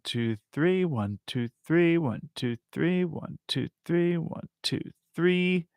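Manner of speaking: counted in groups of three, soft; lilting soft count in threes. Shown as a demonstration. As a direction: tap out one-two-three softly. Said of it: "So you've got this one".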